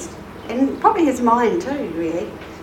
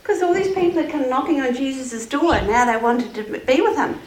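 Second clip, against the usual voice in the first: plain reading voice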